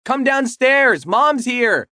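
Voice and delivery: high, loud call up the stairs; yelling out. Acted. Daughter yelling for her brother, loud high call. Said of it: "Devaney yells out".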